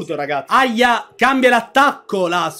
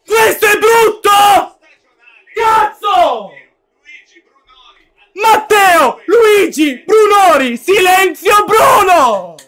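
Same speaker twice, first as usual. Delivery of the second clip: high shouted celebration; ecstatic shouting; 6 semitones higher, 4 dB louder, slower than usual. Real, not acted. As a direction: scream the names. Goal celebration chant.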